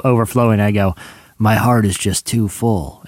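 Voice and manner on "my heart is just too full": low, slow movie deathbed line; dying last words. Acted. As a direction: play a hammy death scene, slowly fading. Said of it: "my last words are like this".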